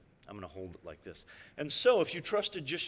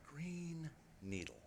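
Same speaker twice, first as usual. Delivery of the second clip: soft spoken words; 14 dB quieter, slower than usual. Shown as a demonstration. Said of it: "you're going to hear the voice say".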